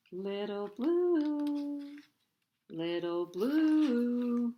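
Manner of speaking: slow, drawn-out faraway call; distant whale song. A narrator's reading. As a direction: sing the name like a whale's song. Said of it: "what Papa said".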